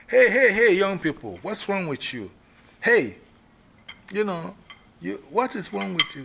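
high, loud commanding elder; Queen Mother scolding. Shown as a demonstration. Queen Mother rehearsing, loudly scolding the young people.

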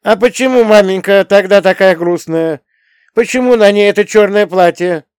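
put-on high, loud child's questioning; piercing, high-pitched boyish distress; unnaturally ringing boy. A narrator's reading. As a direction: demand answers like an upset little boy. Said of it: "Голос мальчика был неестественно звонкий".

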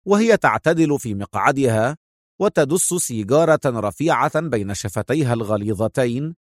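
plain reading voice

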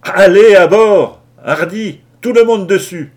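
very loud, high-pitched roar to all hands; thundering shout. A narrator's reading. Captain rallying all hands after the shark warning, thundering. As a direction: bellow orders at full force. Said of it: "Tonitru à Lou Larsen".

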